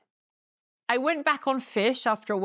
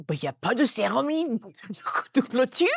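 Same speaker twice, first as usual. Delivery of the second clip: in French. Retold or imitated. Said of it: "in French".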